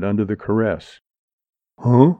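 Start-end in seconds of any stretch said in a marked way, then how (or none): 1.8-2.1 short, loud growl. A narrator's reading. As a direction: growl it out as a rough challenge. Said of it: "growled Porky Martin".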